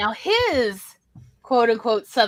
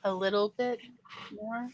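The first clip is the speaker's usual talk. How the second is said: dry sarcastic echo; 11 dB quieter than usual. Real, not acted. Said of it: "needed a little more time".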